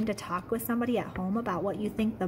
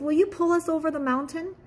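higher, louder begging toy voices; stranded toys begging for help, higher and louder; toys pleading; 6 semitones higher, 3 dB louder than usual. Acted. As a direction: plead with the engine, rising to the last please. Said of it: "cried the dolls and toys".

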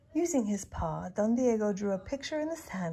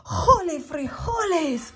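slow, higher, loud exclamation; startled cry; 8 semitones higher, 8 dB louder, slower than usual. A narrator's reading. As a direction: cry out as danger hovers close. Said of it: "cried Skipito".

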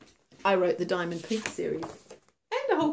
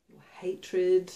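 usual speaking voice

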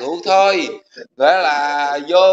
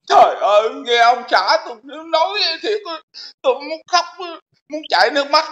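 a hint of a crying old woman, pitched up; mock tearful woman; 5 semitones higher than usual. Host mocks the old woman's tearful words on air, mild mock crying. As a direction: lightly imitate her teary whine. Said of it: "Bà nói".

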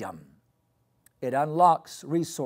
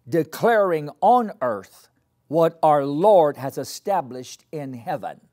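preaching; 6 dB louder than usual. Acted. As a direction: proclaim it to the whole church. Preaching repeated.

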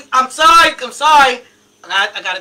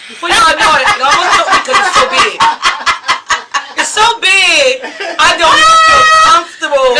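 laughing; 4 semitones higher, 7 dB louder than usual. Real, not acted. Huge egg roll on live.

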